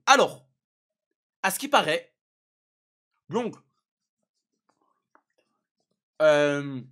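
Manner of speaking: talking through a mouthful; words round a bite of cookie; mouth full. Real, not acted. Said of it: "excusez-moi, je mange".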